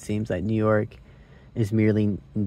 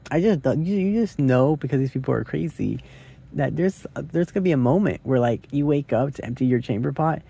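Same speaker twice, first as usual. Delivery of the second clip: usual speaking voice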